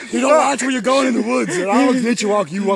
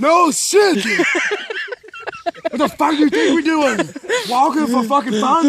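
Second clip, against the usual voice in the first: exasperated outburst; 5 semitones higher than usual. Acted. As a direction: snap back at the obvious. Exasperated reply to companion.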